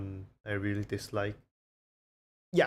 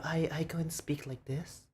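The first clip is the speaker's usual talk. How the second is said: higher-pitched voice for strangers; meeting-new-people voice; 6 semitones higher than usual. Shown as a demonstration. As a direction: lift the pitch for someone you just met. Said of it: "When I see a new people".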